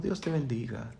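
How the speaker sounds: soft, hushed and very quiet; calm gentle blessing. Retold or imitated. Preacher imitating a calm, gentle believer's hushed blessing. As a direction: murmur it gently like a placid, calm person. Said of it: "Te hablan así, tranquilo".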